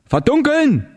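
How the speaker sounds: high, stretched street call; bellowed order. A narrator's reading. Someone in the street ordering blackout, yelled. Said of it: "brüllte da jemand auf der Straße".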